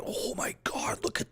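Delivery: hushed amazement; awed whisper. Real, not acted. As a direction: whisper in awe.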